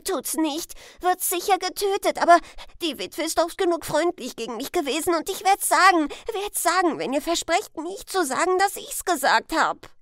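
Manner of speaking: put-on high, loud, rapid boy's outburst; desperate pleading. Huck pleading with the men for secrecy before telling, frantic. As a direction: tumble the words out frantically. Frightened Huck begs, then resolves to tell.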